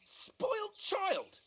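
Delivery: high indignant echo; outraged disbelief. Acted. Hans outraged at insult.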